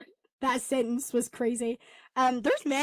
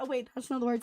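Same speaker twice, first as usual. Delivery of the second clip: ordinary talk, nothing marked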